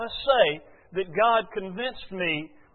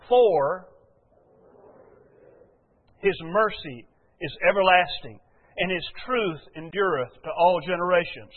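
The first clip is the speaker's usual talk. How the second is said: scripture reading. Acted. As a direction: read the verse out deliberately to the congregation. Reads scripture aloud slowly.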